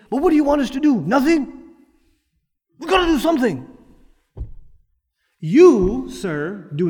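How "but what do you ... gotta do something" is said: mock agitated protester. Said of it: "people come and say".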